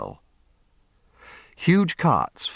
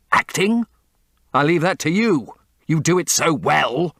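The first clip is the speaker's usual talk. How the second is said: bitter; 9 semitones higher, 5 dB louder than usual. Acted. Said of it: "he answered bitterly".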